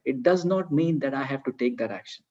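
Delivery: ordinary delivery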